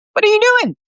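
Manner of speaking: high, loud incredulous question; startled outcry. A narrator's reading. Peter sees Brad drop to the ground, loud startled question. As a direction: cry out in alarmed disbelief.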